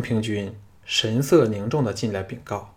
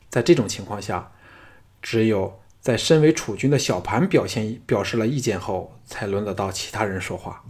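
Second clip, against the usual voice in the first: ordinary delivery, nothing marked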